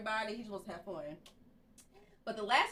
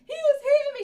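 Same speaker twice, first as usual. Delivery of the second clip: high, loud mock child complaint; playing the victim; 13 dB louder than usual. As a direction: imitate a kid wailing in accusation. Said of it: "He come over being a victim".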